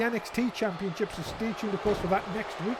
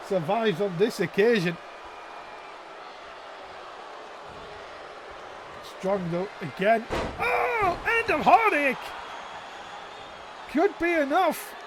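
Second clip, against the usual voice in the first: wrestling commentator; 2 semitones higher than usual. Real, not acted. Live play-by-play commentary.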